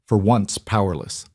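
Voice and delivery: usual speaking voice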